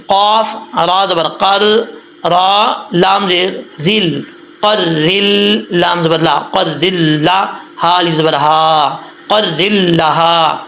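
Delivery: building قر ذل لہا syllable by syllable, very slowly; slow spelling drill. Shown as a demonstration. Teacher builds the word slowly for pupils. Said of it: "spelling out syllables".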